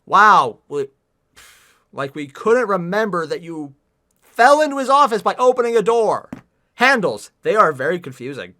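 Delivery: loud, mock-amazed outburst; exasperated heckling; sarcastic. Real, not acted. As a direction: hammer the absurdity home, loudly.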